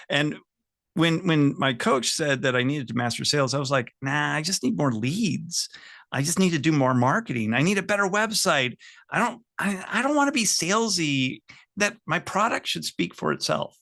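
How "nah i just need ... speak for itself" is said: imitating a reluctant client, climbing higher; mock-defensive objections in a raised pitch; dismissive excuses. Business owner brushing off the coach, mock dismissive excuses.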